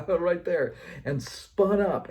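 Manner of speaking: high and loud, spun up; heated. Real, not acted. Dad recalling the moment he snapped, loud and heated. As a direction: let the anger flare. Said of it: "got angry".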